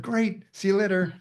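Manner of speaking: high, upbeat brush-off; breezy cheery reply. Retold or imitated. Classmate who did not listen, replying cheerily in passing. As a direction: toss it off cheerfully, pitched high.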